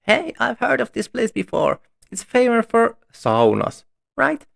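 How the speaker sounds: put-on high, bright young girl voice; girl character voice. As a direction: voice her high and eager.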